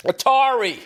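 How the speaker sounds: mock crowd yell, high and loud. Imitating the arena crowd shouting the answer. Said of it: "everybody in the crowd's yelling".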